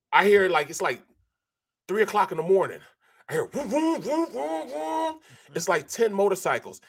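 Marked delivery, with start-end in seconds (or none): 3.5-5.2 high, soft vroom imitation; engine noise. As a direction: mimic motorcycles revving far off. Imitating motorcycles at night.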